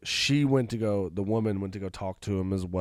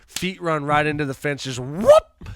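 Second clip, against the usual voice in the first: loud, higher sound-effect whoop; sudden whoop; 5 semitones higher, 8 dB louder than usual. Retold or imitated. Storyteller acting out a crash into the fence, loud whoop. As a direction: punch out the whoop as an impact.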